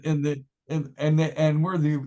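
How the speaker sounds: usual speaking voice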